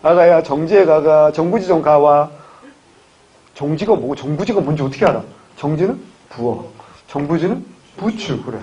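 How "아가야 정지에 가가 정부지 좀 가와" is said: slow, loud Gyeongsang dialect; Gyeongsang dialect mother. Retold or imitated. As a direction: imitate a mother calling her daughter-in-law in Gyeongsang dialect. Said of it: "우리 엄마가". (느릿하고 큰 소리의 경상도 사투리 어머니 흉내)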